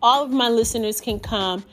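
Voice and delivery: loud, upbeat promo voice; ad read. Acted. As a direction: pitch it like a radio ad. Reads promo for her group.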